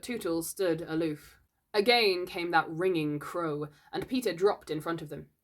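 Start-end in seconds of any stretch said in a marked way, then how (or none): none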